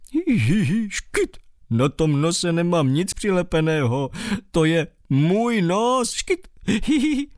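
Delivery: high giggle broken by hiccups; giggling with hiccups. Acted. Mole giggles at the bat, hiccuping between laughs. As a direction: laugh until you hiccup. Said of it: "začal škytat".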